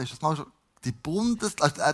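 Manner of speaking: ordinary talk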